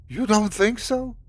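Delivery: high, biting, mock-incredulous; sarcastic whisper. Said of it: "her voice a sarcastic whisper".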